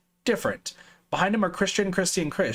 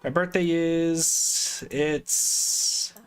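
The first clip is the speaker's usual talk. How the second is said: caught-out stall; much slower than usual. Acted. Fake daughter caught out.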